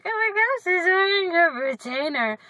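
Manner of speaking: mock a friend teasing. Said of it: "Hannah's gonna be like".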